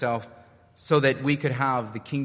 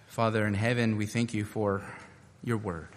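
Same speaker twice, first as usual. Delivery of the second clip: prayer; 5 semitones lower than usual. Acted. Leads closing prayer.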